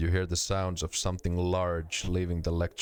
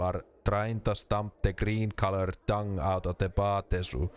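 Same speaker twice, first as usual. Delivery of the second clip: put-on explanation in rally-English Finnish accent. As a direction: lay it out like a Finn. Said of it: "What if I gave him a Finnish accent?".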